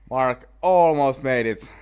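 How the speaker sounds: loud, high excited call; almost-made-it cheer; excited shout. Real, not acted. Near miss on the putt.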